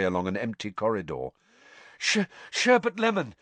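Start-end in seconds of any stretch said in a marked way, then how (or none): 2.0-3.3 panted. A narrator's reading. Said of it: "he panted at it".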